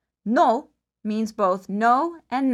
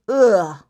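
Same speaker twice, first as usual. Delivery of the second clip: disgusted ugh; 4 semitones lower, 7 dB louder than usual. Shown as a demonstration. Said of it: "stick out your tongue, say".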